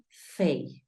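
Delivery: fey with the English ey glide, not Spanish fa. Shown as a demonstration. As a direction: say fey like the start of English favor. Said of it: "la A dice que suena ey".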